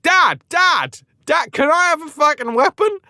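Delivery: put-on high, excited child voice; hammed-up eager kid; pestering boy. Boy pestering his dad.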